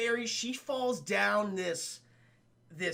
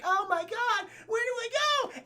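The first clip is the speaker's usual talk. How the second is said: mock panic. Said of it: "She's like".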